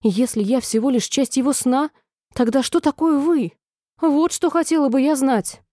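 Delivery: a touch of outraged challenge; indignant. A narrator's reading. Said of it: "в негодовании воскликнула Алиса".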